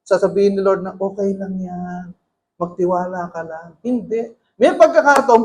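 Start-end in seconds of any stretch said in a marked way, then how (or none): 1.0-3.8 soothing reassurance. Acted. Lord's comforting words voiced.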